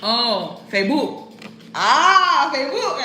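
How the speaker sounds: put-on loud, dawning realization in a Spanish accent. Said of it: "say it with an accent".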